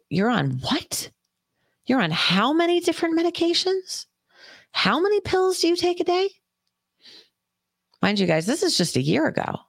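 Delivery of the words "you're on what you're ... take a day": high, astonished doctor voice; a doctor who can't believe the pill count; incredulous doctor. Retold or imitated.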